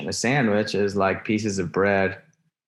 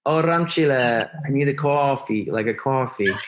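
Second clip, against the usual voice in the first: lightly imitating mom's New Jersey Israeli accent, pitched up; 4 semitones higher than usual. Son imitating his mother's New Jersey Israeli accent. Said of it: "Say it again, say it again".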